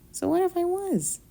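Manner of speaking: mock Southern Belle accent, high and coy. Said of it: "Southern Belle".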